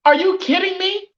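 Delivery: high rising are you kidding me; shocked disbelief. Real, not acted. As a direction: ask it sky-high in real shock. Shocked at Trevor Lawrence ranking.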